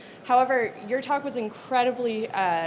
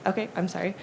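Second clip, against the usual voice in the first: usual speaking voice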